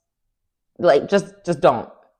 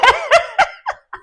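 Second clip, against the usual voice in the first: high burst of cackling laughter. Real, not acted. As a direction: cackle at the clapback. Laughing at Dorit's clapback.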